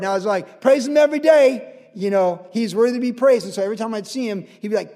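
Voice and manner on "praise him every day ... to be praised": loud, raised call-and-response voice; exuberant praise reply. Retold or imitated. Visitor answering the resident's praise, loud exuberant call. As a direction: answer the praise just as loudly.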